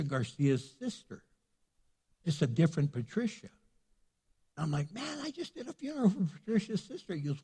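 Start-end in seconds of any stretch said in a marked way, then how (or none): 4.9-7.1 high, quick and hushed exclamation; surprised realization. Retold or imitated. Pastor telling the man of yesterday's funeral, surprised. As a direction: blurt it softly, pitch jumping up. Acts out his surprised reply.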